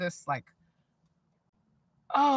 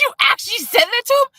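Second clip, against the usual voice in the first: high, loud voice of a shocked cousin; cousin's voice, shocked; 13 semitones higher, 7 dB louder than usual. Retold or imitated. Cousin gasping at what she told a harasser. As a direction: squeal it in disbelief. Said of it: "she goes".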